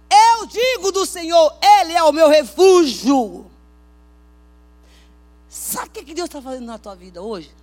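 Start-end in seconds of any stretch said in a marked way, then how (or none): 0.1-3.5 loud, slow proclaiming of the verse; booming pulpit quote; preaching at full force. Acted. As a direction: declare it like a fiery preacher.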